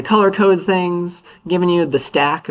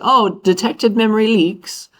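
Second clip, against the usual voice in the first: higher, slower, lightly voiced sanitizer tool; a touch of a mock-surprised tool; voicing the tool; 7 semitones higher, slower than usual. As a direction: speak for the program, a bit put-on. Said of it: "It's like".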